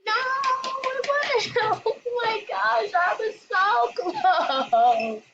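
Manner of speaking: high, loud, crying-out excitement; excited shout. Real, not acted. Player almost winning the round, excited crying shout. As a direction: yell in disbelief at nearly winning. Near win in game.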